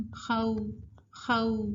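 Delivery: خو as a soft gliding "khau"; no pressure. Shown as a demonstration. Teacher proving waw takes no pressure, soft glide. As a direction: let خو glide from a to u, lips easy. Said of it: "واو پہ کوئی pressure نہیں ہے".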